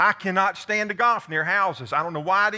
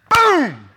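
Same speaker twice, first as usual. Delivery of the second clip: crash shout; 8 semitones higher, 11 dB louder than usual. Retold or imitated. Crash into tree stump.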